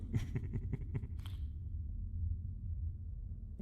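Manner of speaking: quiet breathy scornful laugh; a hushed sneer of a laugh; soft chuckle. Acted. Dragon laughing at the Wicker Man disguise, quiet scornful chuckle.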